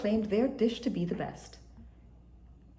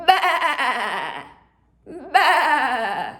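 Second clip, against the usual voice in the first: loud high laughing bleat; loud goat bleat; 5 semitones higher, 6 dB louder than usual. A narrator's reading. Goat showing off his voice with loud bleat. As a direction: blast out a goat's bleat. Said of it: "blurted out the loudest bleat".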